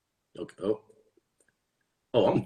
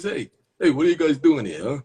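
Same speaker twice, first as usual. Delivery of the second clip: high, louder, swooping character voice; tough guy shooing kids; 5 semitones higher, 4 dB louder than usual. Acted. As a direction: challenge the kids in a raised put-on voice. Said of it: "Does this guy have this voice?".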